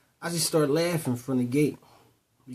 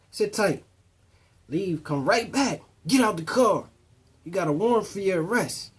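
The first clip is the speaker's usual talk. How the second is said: louder, higher, barking cop commands, retold; stern cop orders; 7 semitones higher, 5 dB louder than usual. Cop orders the driver out, retold stern bark. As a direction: play the officer laying down the law.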